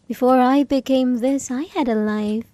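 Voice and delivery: ordinary talk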